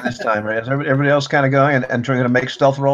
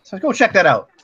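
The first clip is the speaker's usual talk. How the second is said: higher-pitched, startled voice; raised pitch, on the alert; alarmed questioning guard; 6 semitones higher than usual. Acted. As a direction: act out someone suddenly hearing a noise. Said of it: "and somebody says".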